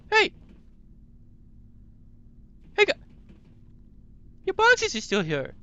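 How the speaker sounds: loud shout up the stairs. Real, not acted. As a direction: yell it to someone far off. Yelling at someone about boxes.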